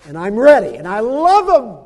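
high, loud, slowed declaration; impassioned cry. Acted. Jesus telling the Father he loves mankind, impassioned cry. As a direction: proclaim it with all your heart.

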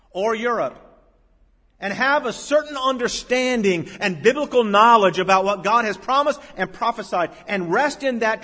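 high, loud, building pulpit delivery; full-force preaching. Acted. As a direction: press each phrase on the congregation.

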